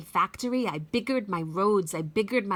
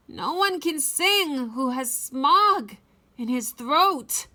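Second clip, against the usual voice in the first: higher, louder, croaking complaint; croaky angry Lorax; 7 semitones higher, 5 dB louder than usual. Acted. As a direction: rant through a smoggy throat. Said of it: "with a crufulous croak".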